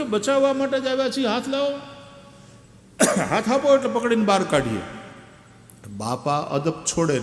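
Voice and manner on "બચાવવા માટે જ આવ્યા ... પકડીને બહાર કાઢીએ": put-on higher, eager young boy coaxing; a lightly voiced kid urging grandpa to give his hand; exasperated boy talking down into a well; boy's voice. Boys coaxing old man out.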